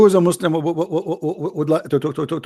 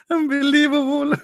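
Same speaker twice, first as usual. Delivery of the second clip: laughing; 13 semitones higher than usual. Real, not acted. Laughing disbelief.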